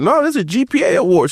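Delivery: high-pitched insistent retort; proud correction. Retold or imitated. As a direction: correct her excitedly, pitch well up. Retold boast correcting his mom.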